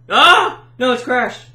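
high, loud wail of dismay; dismayed cry. Real, not acted. Player watching the game crash, loud dismay. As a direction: cry out as if it's all lost.

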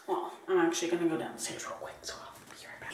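unvoiced whisper, fading out. Real, not acted. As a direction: whisper so no one wakes. Whispered aside, household asleep.

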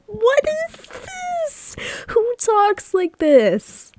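very high, slow, exasperated squeal; appalled disbelief. Real, not acted. Player appalled by cringey dialogue, high drawn-out squeal. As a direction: squeal it out, dragging the words.

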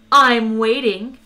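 loud, slow, impatient nanny. Acted. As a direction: tap your foot as you say it. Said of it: "interrupted Eddie".

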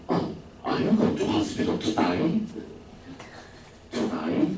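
put-on slow, breathy Australian accent with today sounding like to die. Australian teacher asking a sick colleague, in Australian accent. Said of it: "原来那个澳洲老师说".

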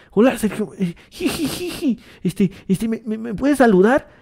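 high, hesitant giggly fan voice; nervous laugh. Retold or imitated. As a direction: stammer and giggle like a shy fan. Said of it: "Esa risa nerviosa como". (fan nervioso con risita)

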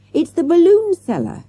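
put-on high child's exclamation; yelling. A narrator's reading. Child unmasking the captive, yelling. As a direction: cry out the discovery, pitched high. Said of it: "yelled Morgan".

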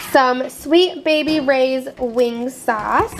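ordinary talk, nothing marked

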